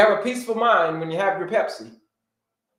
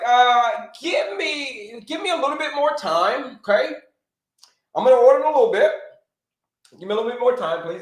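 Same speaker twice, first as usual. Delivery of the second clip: higher, pleading customer voice; stalling customer; 6 semitones higher than usual. Retold or imitated. Customer pleads with the waiter for more time, pitched up.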